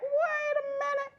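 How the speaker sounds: put-on high, indignant little girl voice; a sassy kid demanding an answer; hammed-up sassy girl. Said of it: "she's like".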